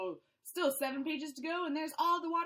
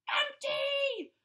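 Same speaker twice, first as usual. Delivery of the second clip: higher and louder melodramatic cry; dramatic wail; 10 semitones higher, 5 dB louder than usual. Acted. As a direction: wail it like a tragic hero. Hammed-up despair.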